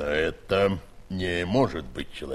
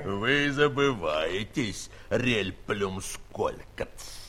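slow rebuke; much slower than usual. Acted. Scholar rebukes Gulliver.

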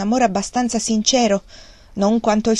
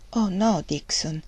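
sorrowful; 5 dB quieter, slower than usual. A narrator's reading. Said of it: "disse Margaret addolorata".

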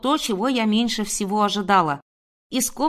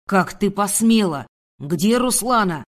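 angry, accusing man's demand; furious man; 3 dB louder than usual. A narrator's reading. Enraged man grabbing the woman by the hair, furious. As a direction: demand it with fury. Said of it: "наполнены неподдельной яростью".

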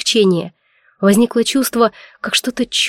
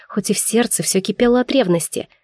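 ordinary delivery, nothing marked

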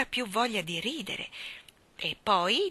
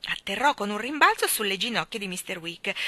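ordinary delivery, nothing marked

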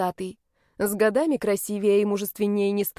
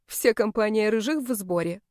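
ordinary delivery

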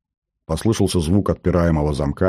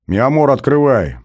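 raised, higher-pitched call; 7 semitones higher, 4 dB louder than usual. A narrator's reading. Man tells his partner next door to open the safe, calling out. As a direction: call an order through to the next room.

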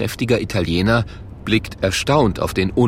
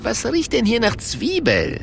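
higher, lighter innkeeper voice; Luigi voice; 11 semitones higher than usual. A narrator's reading. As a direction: ask it like a puzzled barkeeper. Luigi sniffs the onion smell.

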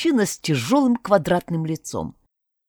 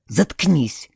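ordinary delivery, nothing marked

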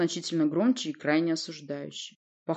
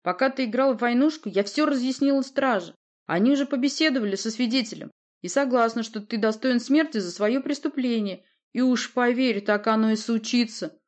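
higher, louder, assertive speech; threatening assurance; 6 semitones higher, 5 dB louder than usual. A narrator's reading. Sorceress tells prince the guards are hers, threatening. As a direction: lay it out as a threat.

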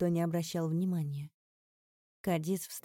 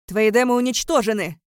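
high, very loud retort; shouted; 4 semitones higher, 14 dB louder than usual. A narrator's reading. Father shouting back at Alaric, furious shout. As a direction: shout it out with full force. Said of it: "выкрикнул мой отец".

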